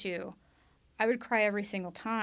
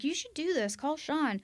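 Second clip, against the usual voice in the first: higher, encouraging push; upbeat urging friend; 6 semitones higher than usual. Retold or imitated. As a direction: nudge her brightly to act. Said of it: "She was like".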